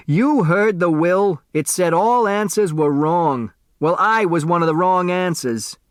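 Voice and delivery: put-on cranky old delivery boy; cranky old man. A narrator's reading. Otis Amber protesting.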